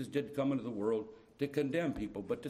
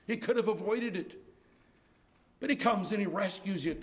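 preaching at full force; 7 semitones higher, 3 dB louder than usual. Real, not acted.

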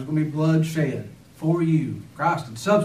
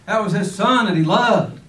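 louder and higher, pressing the point home; emphatic preaching; 5 semitones higher, 7 dB louder than usual. Real, not acted. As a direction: lift the voice on his love for his son. Preacher stressing Abraham's love.

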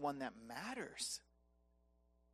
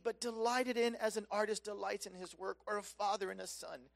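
ordinary delivery

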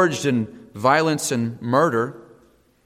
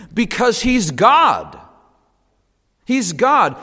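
emphatic proclamation; 6 semitones higher, 4 dB louder, slower than usual. Acted. Preacher's emphatic climax.

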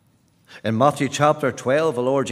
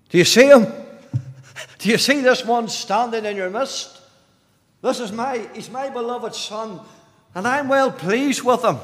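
raised, urgent pulpit appeal; impassioned preaching; 9 semitones higher, 6 dB louder than usual. Real, not acted. Preacher pointing the congregation to the beloved Son, impassioned. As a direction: press the congregation to see it. Preaches, urging listeners to look.

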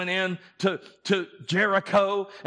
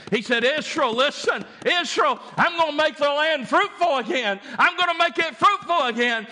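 high, loud building promises; full-force preaching; 10 semitones higher, 5 dB louder than usual. Acted. God promising Israel restoration, loud rising preaching. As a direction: hammer each promise harder than the last.

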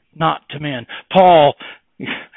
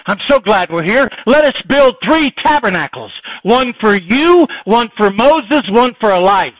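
eager Peter; 9 semitones higher, 8 dB louder than usual. Retold or imitated. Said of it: "He says".

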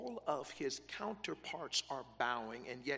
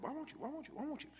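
high, soft, nagging repeats; nagging questioners; 9 semitones higher, 5 dB quieter, much faster than usual. Acted. Preacher voicing the king's servants probing Mordecai, high and soft. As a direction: keep pecking with the question.

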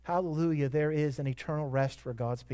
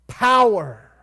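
a loud, slow question, then the answer shouted; called-out answer; 4 semitones higher, 10 dB louder than usual. Real, not acted. Preacher calling out the gospel's power to the church, loud call-out. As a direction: draw out the question, then punch the word Power. Preacher calls out the key word.

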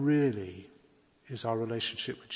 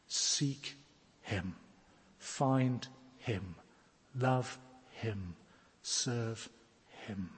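each word set apart with pauses, softening; slow spaced commands; 4 dB quieter, much slower than usual. Real, not acted. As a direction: lay each command down slowly. Sermon climax, paced commands.

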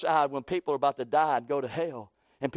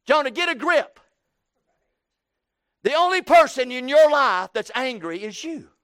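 higher, louder stern rebuke of Jonah; voicing God; 7 semitones higher, 7 dB louder than usual. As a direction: scold Jonah as God would. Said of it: "That's what he's saying to, to Jonah".

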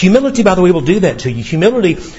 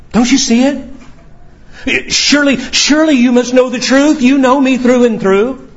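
raised pitch, insistent appeal; earnest, high-pitched protest; pleading; 8 semitones higher than usual. Acted. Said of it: "Peter has to be wondering that".

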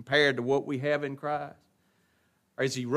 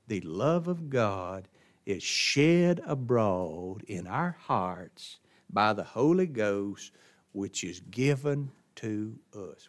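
low, slow, weighted delivery; slow and hushed from the pulpit; solemn scripture reading; 4 semitones lower, slower than usual. Real, not acted. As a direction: let each word of scripture sink in.

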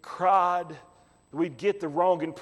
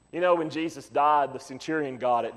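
ordinary delivery

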